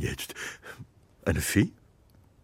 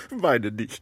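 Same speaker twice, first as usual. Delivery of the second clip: teary laugh; 3 semitones lower than usual. Acted. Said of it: "Hör auf zu heulen".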